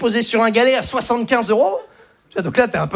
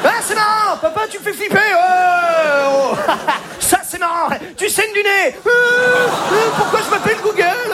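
put-on high, squeaky kid; child voice; 8 semitones higher than usual. A kid mocking his father's joke frog, high and squeaky. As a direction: play the bored kid, high-pitched.

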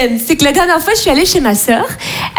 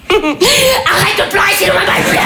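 mère à bout; 6 semitones higher than usual. Acted. Mère parle au bébé.

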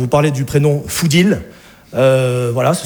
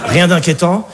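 ordinary talk

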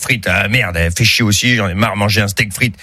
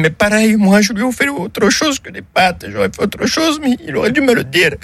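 much higher, mock woman's voice; widow's voice; 13 semitones higher than usual. Italian widow defends herself in a high mock woman's voice. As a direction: squeak it out like the Italian wife.

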